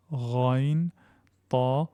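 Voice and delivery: ordinary talk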